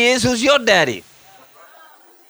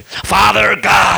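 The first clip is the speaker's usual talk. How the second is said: religious praying voice; much slower than usual. Acted. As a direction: pray it with showy religious fervour. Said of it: "We get religious, don't we".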